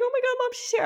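very high, squealed mock joy; gushing, high-pitched happy-cry imitation; mock happy tears. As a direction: imitate an overjoyed new mother's squeal. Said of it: "not, like, happy tears".

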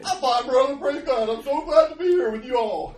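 high, booming fake-happy church greeting; loud mock praise-the-Lord enthusiasm; over-the-top cheery. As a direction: belt out a hammed-up overjoyed reply. Acting fake-happy answer to greeter.

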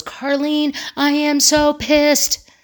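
high-pitched mock fury, drawn out slowly; a friend on the phone imitated, pitched high, slow and fuming; imitating angry friend. Friend venting anger on phone.

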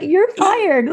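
high-pitched, laughing mock firing. Patient retells firing her first doctor, high and laughing. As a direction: exclaim it high, amused at your own boldness.

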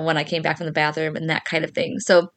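ordinary delivery

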